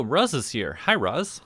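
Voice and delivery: ordinary talk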